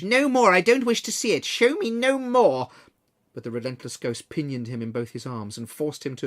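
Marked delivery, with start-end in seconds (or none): 0.0-1.4 high, loud anguished cry; cried out. A narrator's reading. Scrooge cries out to the ghost to stop, loud and anguished. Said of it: "cried Scrooge". 1.4-2.7 high, loud and slow, begging; loud anguished plea. A narrator's reading. Scrooge begs the ghost to show no more, a loud slow wail. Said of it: "cried Scrooge".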